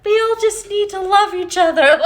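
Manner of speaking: high, loud, quick parody with a laugh; laughing sing-song mockery; mock naive positivity. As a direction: poke fun at spiritual bypassers. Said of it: "and they're like".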